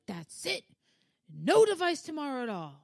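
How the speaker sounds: high-pitched, exasperated mom outburst; blowing her top. Retold or imitated. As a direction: lay down the punishment, pitch jumping up. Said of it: "I blew my top".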